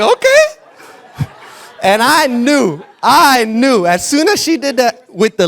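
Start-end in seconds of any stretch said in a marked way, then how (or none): 0.0-1.3 a squeaky, much higher, laughing okay; nervous okay. Retold or imitated. Pastor caught off guard by the woman's fervor, high and squeaky. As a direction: let it squeak out of you. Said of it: "I was like".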